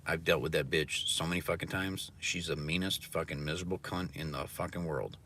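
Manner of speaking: ordinary talk, nothing marked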